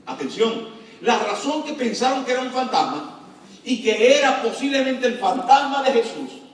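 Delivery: ordinary delivery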